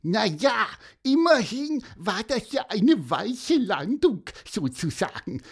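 very high, grinning bird voice; cheeky little bird. Acted. Little bird teasing about his friend's belly, grinning squeak. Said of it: "grinste Pieper".

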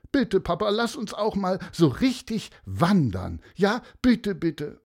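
put-on high, pleading child voice; begging child. A narrator's reading. Children beg their father to go hiking, pleading high child voice. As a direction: beg papa like an eager kid.

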